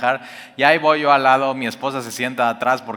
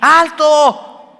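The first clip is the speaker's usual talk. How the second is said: much higher, much louder yell; shout; 13 semitones higher, 8 dB louder than usual. Shown as a demonstration. Preacher showing parents how to yell stop, full shout. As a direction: bark it as an emergency command. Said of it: "vas a tener que hacer así".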